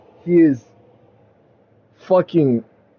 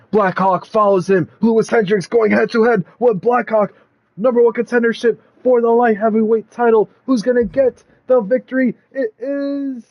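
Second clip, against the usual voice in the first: higher, fast announcer call building up; the announcer builds up to the result, higher and fast; ring announcer hype; 6 semitones higher than usual. Acted. Ring announcer calls the match.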